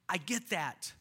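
ordinary talk

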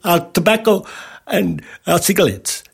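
imitated Indian accent. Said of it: "He was an Indian fella".

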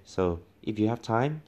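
normal talking voice

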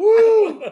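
high, loud whoop; laughing whoop. Real, not acted. Tour guide cracking up at a friend's joke, loud whoop. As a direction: let out a big high woo through laughter.

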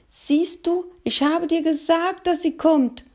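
put-on high boyish voice, triumphant. Boy tells his little brother he was right, triumphant and high. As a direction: crow like a proud little boy. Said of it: "triumphierte er".

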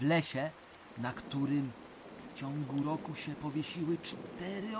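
put-on a grandfather lowering his voice to spook the kids; hushed spooky storytelling. Grandpa telling the children ghost stories, hushed and eerie. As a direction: drop the voice low and soft for the ghost tales.

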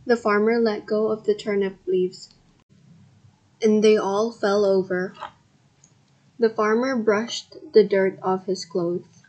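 ordinary delivery, nothing marked

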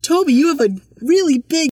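loud, high-pitched mock shout; really loud. Friend calling to Toby at lunch, really loud. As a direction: blurt it out loudly across the room. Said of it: "she said it really loudly".

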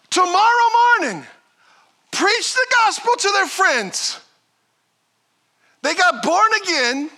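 high, loud fired-up preaching; rally the church to go and tell; preaching at full force. Acted. Pastor urging church to go, loud fervent preaching.

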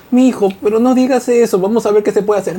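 high pleading mum voice; madre suplicando. Retold or imitated. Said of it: "es la mamá que le está". (voz aguda de madre suplicando)

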